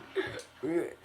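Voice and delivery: sudden choking cough; coughing fit. Real, not acted. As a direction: cough hard, caught off guard. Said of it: "I was choking on my own fucking oxygen".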